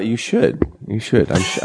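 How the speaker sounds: ordinary talk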